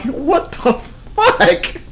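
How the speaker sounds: very slow, drawn-out incredulous; stunned disbelief. Retold or imitated. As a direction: let the disbelief stretch out. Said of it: "I was like".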